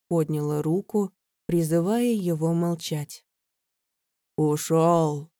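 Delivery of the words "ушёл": booming creature voice. A narrator's reading. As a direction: boom it out like a ghoul. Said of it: "гулко произнёс он наконец".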